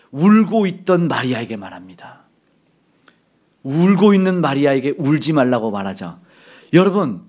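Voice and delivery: normal talking voice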